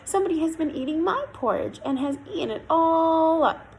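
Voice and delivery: high little bear squeak; squeaky voice. A narrator's reading. Little Bear finds his porridge all eaten, squeaky. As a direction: squeak it, stretching out the dismayed ending. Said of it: "said in his squeaky voice".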